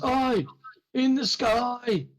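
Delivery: high, slow yelled warning from the tower; guard calling down. Acted. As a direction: call it down from the gate tower. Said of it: "yells down to you".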